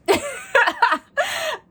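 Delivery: a high burst of laughter at herself. Real, not acted. Laughing at not meditating or doing yoga. Laughs at own failed plans.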